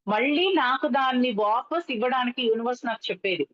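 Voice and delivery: normal talking voice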